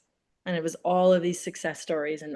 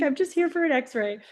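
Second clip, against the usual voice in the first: meek plea; 9 semitones higher than usual. Retold or imitated.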